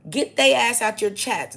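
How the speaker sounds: loud, high and slow command; heated shout. Real, not acted. Telling viewers to remove strangers from chat, loud slow shout. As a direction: order them out with force.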